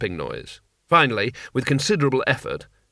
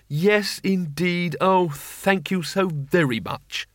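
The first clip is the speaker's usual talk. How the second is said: higher, slow, dripping sarcasm; sarcastic thanks; 4 semitones higher, much slower than usual. Acted. Rincewind thanking fate for the snow, sarcastic and slow. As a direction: thank the sky with heavy sarcasm, slowly.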